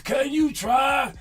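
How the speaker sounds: high, loud, slow "Can you try?" yelled; a fed-up coach yelling from the sideline; mock coach shout. Coach yelling at a struggling player, high and slow. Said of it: "And your coach going".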